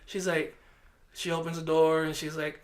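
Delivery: ordinary talk, nothing marked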